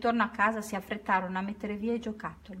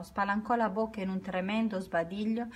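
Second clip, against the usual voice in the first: usual speaking voice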